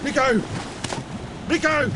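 high, desperate calls across water; calling out. Acted. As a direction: call out into the sea. Calling the boy overboard.

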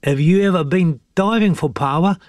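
put-on high boyish voice; high, chatty boy character; young boy Hemi. A narrator's reading. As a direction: ask your mate, kid-like. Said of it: "asked Hemi".

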